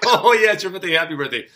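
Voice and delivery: loud, high mock realization, easing off at the end; playful teasing. Neighbor playing with her on her birthday, playful teasing. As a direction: put on a big sudden remembering. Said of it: "He's just playing with her a little bit".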